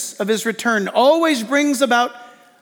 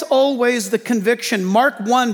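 ordinary talk, nothing marked

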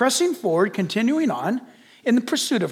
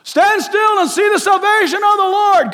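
high, loud, fast then shouted command; urgent God; 9 semitones higher, 5 dB louder than usual. Pretend. God tells Moses to get moving, loud high shout. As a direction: drive him forward with a shout. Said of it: "God says".

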